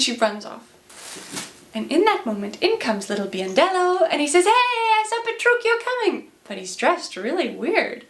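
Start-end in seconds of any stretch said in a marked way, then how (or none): 4.4-6.3 messenger's high-pitched holler; calling out news. Retold or imitated. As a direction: hail the crowd from a distance. Messenger calls out news on arrival.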